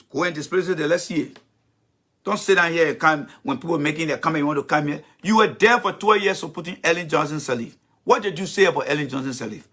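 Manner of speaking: ordinary delivery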